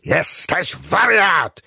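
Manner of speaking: high, drawn-out cry of rage at normal volume; furious cry. A narrator's reading. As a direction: cry it out slowly, near apoplexy. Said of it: "z wściekłości i kurczowo trzymając się stołu krzyczał".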